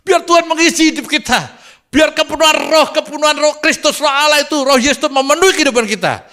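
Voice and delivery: very high, ringing revival exhortation; impassioned preacher's declaration, pitched high; full-force preaching. Acted. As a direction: proclaim a blessing over the congregation at full cry.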